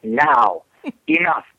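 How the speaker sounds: "Now" and "enough" as separate falling commands; natural growl. Shown as a demonstration. Trainer shows woman commanding dog, "Now" and "enough" with natural growl. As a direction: start "Now" and "enough" high, drop them firmly. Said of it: "it gives you a natural growl without growling".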